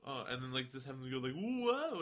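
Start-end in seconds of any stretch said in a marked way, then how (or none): 1.3-2.0 high, loud mock whoa of tripping; a hammed-up stumble cry. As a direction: yelp as if stumbling. Acting a character tripping.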